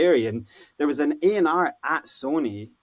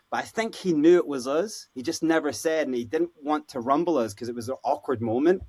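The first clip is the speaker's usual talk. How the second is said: ordinary talk, nothing marked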